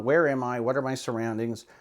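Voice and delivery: normal talking voice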